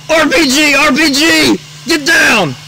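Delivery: full-force high screamed warning; frantic battlefield shout; pilot's yell. Acted. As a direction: yell an urgent alarm at the top of your voice. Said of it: "the helicopter pilot yells".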